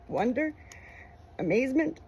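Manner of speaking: plain reading voice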